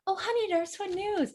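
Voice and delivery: high-pitched honeyed coaxing after a gasp; butter someone up in a high, sugary voice; sweet-talking. Acted. Juliet greets the nurse hoping for news, sweet-talking. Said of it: "she is all sweet talking".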